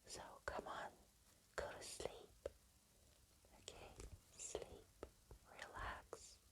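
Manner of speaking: soft, far-off hushed goodnight; a faint, breathy bedtime murmur; bedtime whisper. Real, not acted. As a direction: whisper them gently toward sleep.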